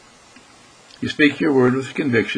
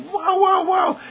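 much higher shouted wows; ecstatic wow; slower than usual. Pretend. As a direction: cry out in pure delight. Ecstatic exclamation.

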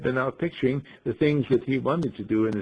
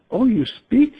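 high, louder startled question; startled surprise; 10 semitones higher, 6 dB louder, slower than usual. Acted. Ingeborg startled that the bird answers her, a high surprised gasp. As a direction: gasp it out, amazed the bird talks. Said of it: "she says".

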